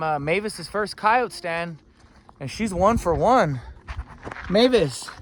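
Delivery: loud, high and excited; pumped up after the hunt. Real, not acted. Excited return, calling the dog.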